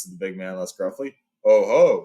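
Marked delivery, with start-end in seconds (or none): 1.4-2.0 loud, amused oh ho; knowing surprise. A narrator's reading. Beorn hearing of goblin trouble, loud and amused. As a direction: catch the stranger out with relish.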